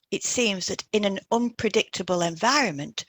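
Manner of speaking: ordinary talk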